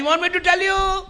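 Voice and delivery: high, loud, slow drawn-out call. Retold or imitated. Handcuffed Misam taunts Ibn Ziyad, calling out slowly. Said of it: "پکار کے کہا".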